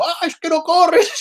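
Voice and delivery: imitated shout, high and slow; mock the mother screaming from the sideline; mother screaming at her exhausted son during a match; mother yelling.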